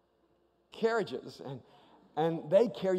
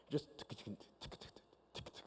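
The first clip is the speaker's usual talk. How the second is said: quiet voiceless ticking in little bursts; soft mechanical tick-tick; imitated ride clicking; 8 semitones lower, 18 dB quieter, faster than usual. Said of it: "It was just bumping along".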